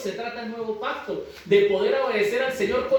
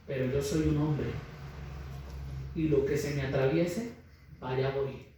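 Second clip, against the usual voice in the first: subdued retelling; 5 semitones lower, 8 dB quieter than usual. Retold or imitated. Husband's excuse retold gravely.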